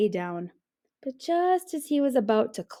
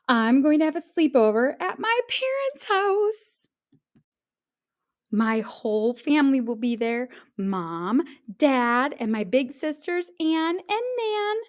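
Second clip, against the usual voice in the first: put-on excited child; 5 semitones higher, 4 dB louder than usual. A narrator's reading. Jim voiced, excited.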